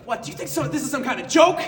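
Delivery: mock angry demand. Marie demanding if the call is a joke, mild mock anger. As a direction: demand it indignantly. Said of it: "very angry at me".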